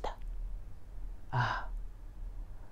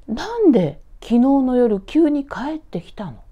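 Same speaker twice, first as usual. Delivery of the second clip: puzzled mother; 7 semitones higher, 6 dB louder than usual. Acted. Mother asks about sudden visit.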